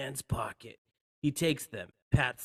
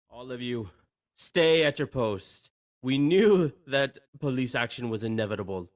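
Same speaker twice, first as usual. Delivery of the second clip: commanding order; 6 dB louder than usual. Acted. Hans ordering terrorists over radio.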